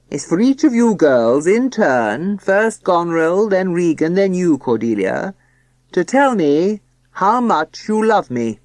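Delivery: slow, loud, grand pronouncement; pompous father voice. Acted. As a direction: lay down the rules pompously. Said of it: "solemn, pompous voice".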